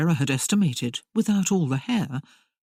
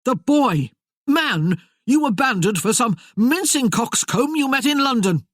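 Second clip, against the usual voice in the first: bitter accusation; 8 semitones higher, 5 dB louder than usual. Acted. Merlin accusing Tamara of abandoning him.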